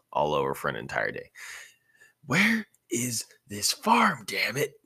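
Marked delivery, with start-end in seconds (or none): 2.3-4.8 strained whisper, slow and high; growled. A narrator's reading. Naruto fed up with the long haul, whispered growl. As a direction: grind it out in a hoarse whisper. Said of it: "Naruto growled".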